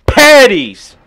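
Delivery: loud, high jeering yell; mocking shout. Real, not acted. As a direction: yell it to roast a friend. Mocking friend in heated banter.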